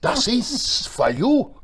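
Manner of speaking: put-on high, slow German-accented presentation. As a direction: hand it over in a German accent. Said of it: "they come running over there".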